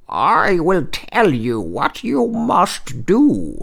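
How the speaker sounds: put-on slow, high old man voice; little old man. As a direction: advise slowly in a high old man's voice. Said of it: "said the old man".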